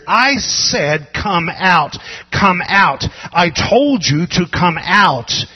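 loud, slow, insistent order; thundering preacher's rebuke; shouted command. Acted. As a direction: hammer it home like an exorcist.

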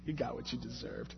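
hushed, slow whisper to himself; whispered scolding. Real, not acted. Preacher scolding his younger self in a hushed whisper. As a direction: whisper it like a private rebuke. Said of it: "Shut up".

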